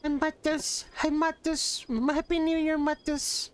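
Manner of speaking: high sing-song greeting; high cutesy voice. Real, not acted. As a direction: coo it up high like to a pet.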